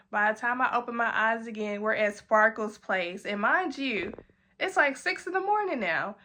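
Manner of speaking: ordinary talk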